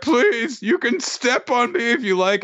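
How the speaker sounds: high, simpering plea; groveling high voice. Retold or imitated. Dosan begging Dora, high simpering grovel.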